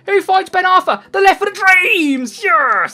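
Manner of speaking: loud, high celebration; excited goal shout. Real, not acted. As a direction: build the call into a triumphant yes. Calls own goal going in.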